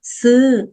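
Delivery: sư said high and lifted in Lao; Lao word. Shown as a demonstration. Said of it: "từ này đọc là sư". (đọc mẫu từ sư giọng cao)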